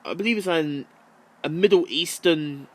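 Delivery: normal talking voice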